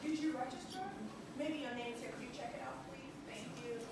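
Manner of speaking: usual speaking voice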